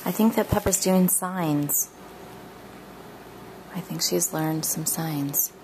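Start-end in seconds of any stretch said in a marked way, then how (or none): 3.7-5.5 unvoiced whisper. Real, not acted. Aside while filming the baby.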